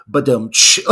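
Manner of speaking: spoken drum-and-cymbal sting; imitated rimshot. Podcaster marking a little sex joke, imitated rimshot. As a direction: punch out a mock rimshot.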